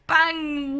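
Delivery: usual speaking voice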